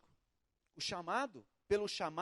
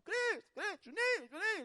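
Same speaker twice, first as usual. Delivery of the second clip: higher, sing-song calling; repeated calling; 11 semitones higher than usual. Retold or imitated. Boy calling friends again and again, light high call. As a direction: call the names over and over. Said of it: "fica chamando".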